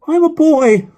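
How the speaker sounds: put-on high, loud boyish protest; high-pitched little boy on the phone. A narrator's reading. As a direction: pipe up loudly in a young boy's voice. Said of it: "his voice higher pitched".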